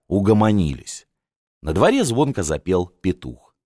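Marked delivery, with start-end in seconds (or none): none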